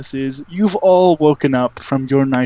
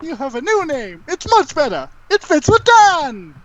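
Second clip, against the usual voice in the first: insistent wizard creature; 14 semitones higher than usual. Acted. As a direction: insist brightly in a high character voice, climbing higher.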